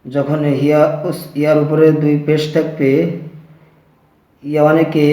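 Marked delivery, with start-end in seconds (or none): none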